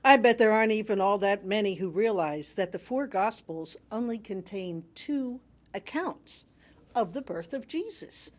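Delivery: ordinary talk, nothing marked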